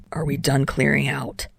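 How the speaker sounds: a low, restrained bark of a question; mild bark. A narrator's reading. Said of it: "Dynamite barked".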